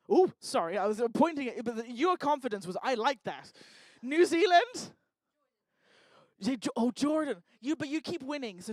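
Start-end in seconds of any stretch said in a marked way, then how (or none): none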